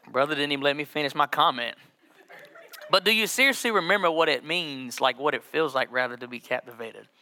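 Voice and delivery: usual speaking voice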